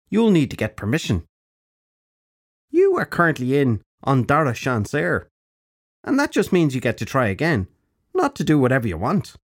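put-on high, bright-edged old witch; old crone voice. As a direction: speak as a brisk old crone. Crone character voice.

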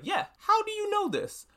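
imitating Homelander going up high, caught off guard; mock high surprise. Homelander reacts to Madame Vought.

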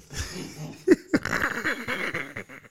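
high, drawn-out laughter; long laugh. Real, not acted. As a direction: keep laughing, high and helpless. Laughing at the violating accusation.

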